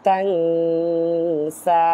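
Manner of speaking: তাং held with a long nasal hum before সা; ikhfa ghunnah. Shown as a demonstration. Teacher models ikhfa through the nose, long nasal তাং. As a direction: hide the n of তাং in the nose and draw it out. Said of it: "নাকের মাধ্যমে".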